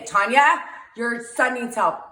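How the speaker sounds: hammed-up alarmed warning, near a yell; mock-dramatic phone warning at its peak; dramatic loud dad. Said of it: "That's all I can say".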